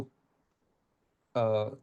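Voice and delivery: ordinary talk, nothing marked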